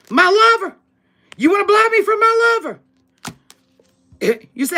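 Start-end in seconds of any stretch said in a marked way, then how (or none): 0.1-2.8 pitched up high in mock outrage; a retort, higher and indignant.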